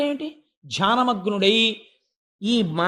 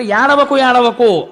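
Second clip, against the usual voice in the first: loud, raised plea to stop crying; loud soothing hush; 9 dB louder, much slower than usual. A narrator's reading. Brahma calling over his crying newborn, loud hush. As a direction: call out over a wailing baby to hush it.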